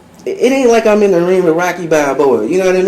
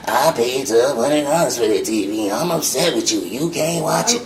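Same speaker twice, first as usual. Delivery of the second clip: mock girlfriend voice; 8 semitones lower, 7 dB quieter than usual. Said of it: "you can't be like".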